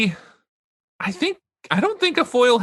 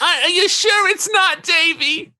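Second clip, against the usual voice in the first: mock Davy-doubter; 11 semitones higher than usual. Joining in as a character.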